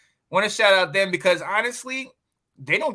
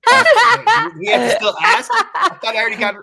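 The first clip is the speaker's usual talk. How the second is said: laughing; 13 semitones higher, 8 dB louder, faster than usual. Real, not acted. Laughing reply about Valentine.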